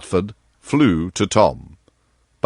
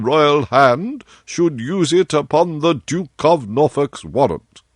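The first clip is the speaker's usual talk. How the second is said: raised, formal courtier's voice; a humble official petitioning his king; Lord Chancellor; 7 semitones higher, slower than usual. Acted. Lord Chancellor asking the king for the seal, raised and deferential.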